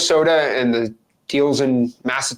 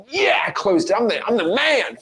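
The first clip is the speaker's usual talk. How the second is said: higher-pitched mock victory crow; mock bragging; 6 semitones higher than usual. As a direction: imitate a guru congratulating himself. Guru brags after closing the call.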